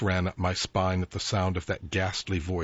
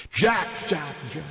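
high, gleeful spectral hiss; a ghost savoring its prey; delighted ghostly hiss; 8 semitones higher than usual. A narrator's reading. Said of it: "the voice hissed delightedly".

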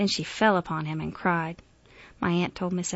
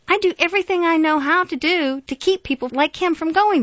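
high, loud, driving self-defence; heated, forceful protest; exasperated outburst; 10 semitones higher, 9 dB louder than usual. A narrator's reading. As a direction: pour it out in angry frustration. Grant's angry outburst to his aunt.

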